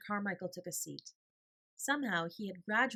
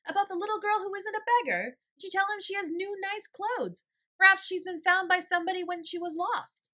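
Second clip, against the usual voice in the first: put-on much higher, louder child's shout; shouting boy; 13 semitones higher, 9 dB louder than usual. A narrator's reading. Little Donald shouting his question across the room, much higher and louder. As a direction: call out like an eager small boy. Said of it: "Donald shouted again".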